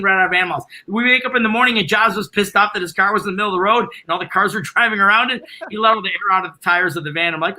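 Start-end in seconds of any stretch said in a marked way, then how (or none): none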